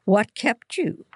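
high-pitched cross question; scolding. A narrator's reading. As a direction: demand an explanation, mildly scolding. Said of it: "Mother Anderson scolded".

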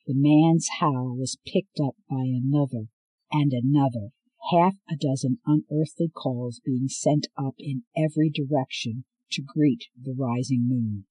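plain reading voice

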